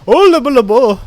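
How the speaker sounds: mock gibberish. Mocking gibberish voice.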